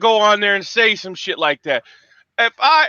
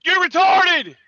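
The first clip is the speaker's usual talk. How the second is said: angry yelling; 6 semitones higher, slower than usual. Real, not acted. Angry rant.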